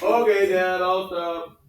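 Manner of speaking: high, loud mock surrender; hammed-up yielding to Dad; surrendering kid. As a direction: cave in like a smacked child. Voices brother finally giving in.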